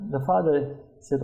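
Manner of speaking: ordinary delivery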